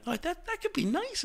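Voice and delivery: high, soft wistful musing; dreamy inner voice. Retold or imitated. Tempted by soft jazz, a high wistful thought. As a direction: float the idea up high and hopeful. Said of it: "I'll think".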